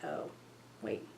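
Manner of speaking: usual speaking voice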